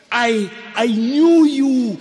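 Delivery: "I, I knew you" high, loud and slow, stressing "knew"; jubilant God voice. Acted. God proclaiming to Jeremiah, high loud and slow. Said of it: "God says to Jeremiah".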